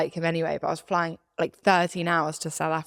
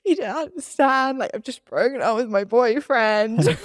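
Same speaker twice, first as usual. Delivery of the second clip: mock crying plea; 5 semitones higher than usual. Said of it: "I'm crying to every person".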